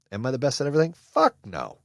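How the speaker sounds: normal talking voice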